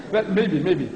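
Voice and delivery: ordinary talk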